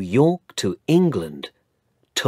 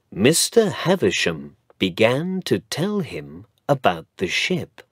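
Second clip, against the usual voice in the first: ordinary delivery, nothing marked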